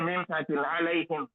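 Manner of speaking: ordinary delivery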